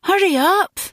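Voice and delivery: high-pitched, impatient call; urgent urging. A narrator's reading. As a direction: hurry him along, voice pitched well up. Urging the digger on in graveside scene.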